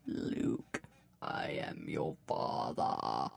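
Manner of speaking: slow deep whispered villain; Darth Vader voice. Retold or imitated. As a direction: breathe it slow and menacing. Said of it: "this is what it sounded like on the last show".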